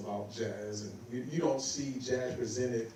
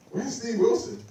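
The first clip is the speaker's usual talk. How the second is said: high, loud, pointed question; pointed demand; 11 semitones higher, 8 dB louder than usual. Retold or imitated. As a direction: call out the missing player. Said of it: "he said".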